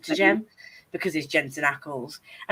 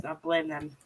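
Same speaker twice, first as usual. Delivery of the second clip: ordinary talk, nothing marked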